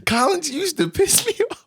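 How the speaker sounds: high-pitched exasperated complaint; exasperated venting. Real, not acted. Player venting about teammate Collins, high exasperation.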